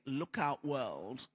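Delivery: usual speaking voice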